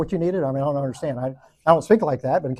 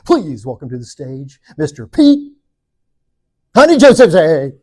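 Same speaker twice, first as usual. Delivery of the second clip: announcer intro; 11 dB louder, slower than usual. Retold or imitated. An emcee introducing the keynote speaker, big announcer voice. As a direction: boom the name out like an emcee botching it. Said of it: "if you see them introduce me as the keynote".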